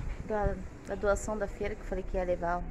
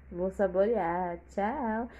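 normal talking voice

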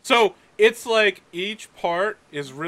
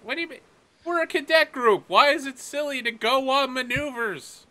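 higher voice, defensive; defensive midshipman; 7 semitones higher than usual. Acted. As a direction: defend the cadet group. Cadet argues on radio.

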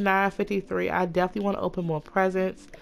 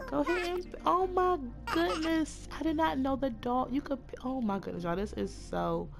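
higher, slow cooing over something cute; real delighted cooing; gushing coo; 5 semitones higher, 5 dB quieter, slower than usual. Real, not acted. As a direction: melt over the puppy, drawn out. Gushing over cute Sims dog.

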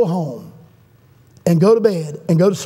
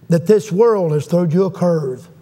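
usual speaking voice

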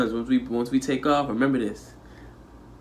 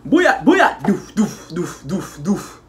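high, loud mock Jamaican booyah and doof noises; hammed-up Jamaican dancehall noises, up high; mock Jamaican sounds; 7 semitones higher, 4 dB louder, much slower than usual. Joking about Jamaicans fighting, hammed-up high Jamaican noises. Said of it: "You know how the Jamaicans be".

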